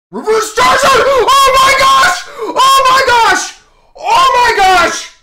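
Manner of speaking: shrieking, laughing, slow drawn-out oh my gosh; screaming with joy. Real, not acted. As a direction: scream at the top of your lungs in disbelief. Pulling rare card.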